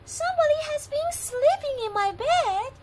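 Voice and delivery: very high, loud squeak, slowing at the end; a tiny cub announcing the intruder; Baby Bear squeaks. Acted. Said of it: "squeaked in his little voice".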